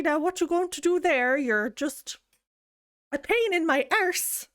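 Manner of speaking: hammed-up Irish scold, pitched high; angry Irish lady. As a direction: huff at them like a cross Irish lady. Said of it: "I'm an angry, like, lady".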